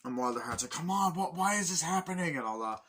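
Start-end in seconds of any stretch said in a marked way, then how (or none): 0.7-2.4 high, loud upset pleading; a mock anguished why; distressed outburst. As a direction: cry out in frustration.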